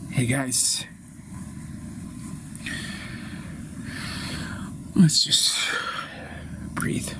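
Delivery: low, slow, spaced-out phrases; calming. Acted. Peacemaker urging quarrelling friends to breathe, calming. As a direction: soothe them with long pauses to breathe.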